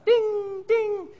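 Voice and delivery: high piercing bell ding; ping like a flight call bell; high mock bell chime. Pressing call button.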